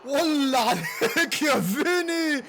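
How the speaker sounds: high, slow, stretched celebration yell; drawn-out joyful shout; ecstatic goal shout. Real, not acted. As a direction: roar it out as the ball hits the net.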